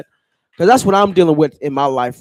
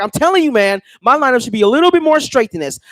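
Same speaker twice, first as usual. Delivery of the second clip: heated, raised-voice insistence to the barber, fast; fuming complaint; 8 semitones higher, 4 dB louder, faster than usual. Retold or imitated. Customer fumes at his barber over a crooked lineup. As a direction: push back hard on the barber about the lineup. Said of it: "I'm in the chair fuming".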